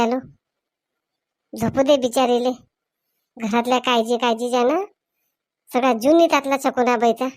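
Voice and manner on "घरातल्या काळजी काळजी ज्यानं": normal talking voice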